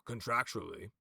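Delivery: pointed, probing echo of the term; Magin. Acted. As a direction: pick up the word as a challenge. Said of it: "you watch Magin go".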